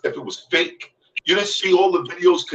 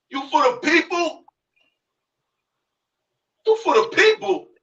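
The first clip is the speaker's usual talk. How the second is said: much higher, scornful question; mock his claim to be for the people; 10 semitones higher than usual. Real, not acted. Mocking his rival's claim.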